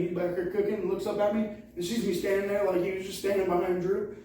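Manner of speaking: normal talking voice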